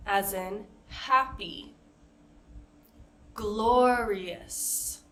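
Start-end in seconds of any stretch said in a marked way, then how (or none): none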